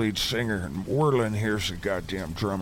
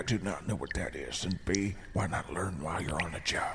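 breathy whisper while sneaking; 6 dB quieter than usual. Acted. Adventurer whispering to the slayer near the monster. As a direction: keep it under your breath.

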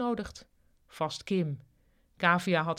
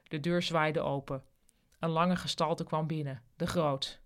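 ordinary delivery, nothing marked

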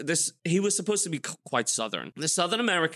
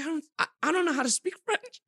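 high-pitched panicked protest; a high, flustered plea to the producers; 14 semitones higher than usual. Retold or imitated. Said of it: "I'm like".

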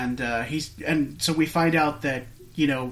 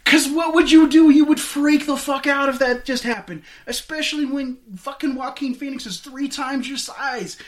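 excited; 10 semitones higher, 6 dB louder than usual. Real, not acted. Excited retelling of the scene.

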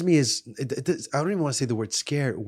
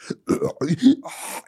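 imitated high, eerie gasp; possessed person's gasp; 13 semitones higher, 4 dB louder than usual. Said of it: "suddenly you hear this".

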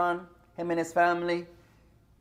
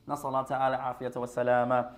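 rapid Arabic prayer; 7 semitones lower, 5 dB quieter, much faster than usual. Acted.

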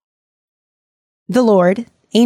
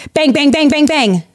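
pounding noise; 6 semitones higher than usual. Retold or imitated. Mob pounding on door.

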